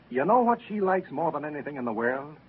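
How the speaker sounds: ordinary talk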